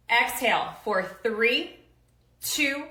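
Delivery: drawn-out coach countdown; coaching count. Shown as a demonstration. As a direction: pace them through the count. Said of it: "All right, you try".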